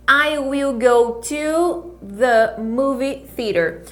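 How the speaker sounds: "I will go to the movie theater" spoken slowly, each word clear; slow English teacher model; careful pronunciation. Shown as a demonstration. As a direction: model the sentence slow and deliberate. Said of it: "atenção nessa pronúncia aqui".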